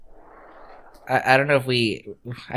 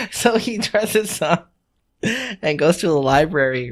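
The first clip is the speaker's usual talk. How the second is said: ordinary talk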